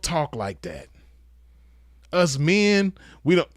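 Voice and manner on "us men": ordinary talk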